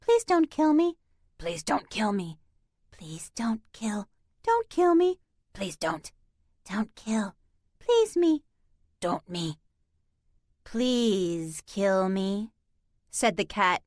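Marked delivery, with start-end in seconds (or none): 0.0-9.6 eerie overlapping mimicry; babbling cat voices. A narrator's reading. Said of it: "They all started to talk over each other". 10.6-12.5 slow eerie cat voice. A narrator's reading. Eerie cat mimic, slowed.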